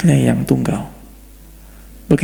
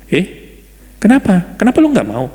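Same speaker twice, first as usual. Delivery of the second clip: surprised God; 6 semitones higher than usual. Pretend. God's surprised reaction voiced.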